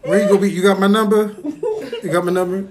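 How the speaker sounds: mock nurse talk, laughing. Acting out a clinic visit with mom, laughing. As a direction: play it loud and giggly.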